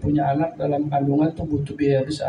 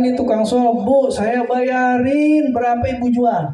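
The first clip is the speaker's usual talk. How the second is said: higher, louder eager offer to pay; urgent offer; 8 semitones higher, 4 dB louder than usual. Acted. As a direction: press the widow to sell, eagerly. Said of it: "Kata Ali Al-Muwafaq dikatakan". (menawar dengan suara tinggi dan keras)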